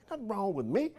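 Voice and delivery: soft countrified words to yourself; muttered to the mirror. Shown as a demonstration. Speaker shows talking to yourself in the mirror, soft and countrified. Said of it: "just look at it, go".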